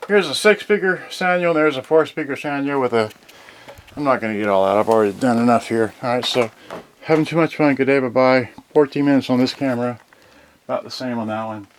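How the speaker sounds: ordinary talk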